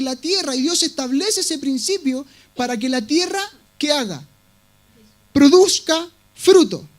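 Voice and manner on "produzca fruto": slow and louder, each word driven home; emphatic punchline. Acted. Preacher to congregation landing his main point, slow and forceful. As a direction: drive home "Produzca fruto" like a verdict.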